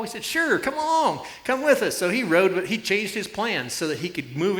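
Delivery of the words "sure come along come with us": much higher, bright welcoming call; cheerful invitation. Retold or imitated. Riders cheerfully inviting the lonely rider to join them, high and eager. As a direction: wave him in eagerly.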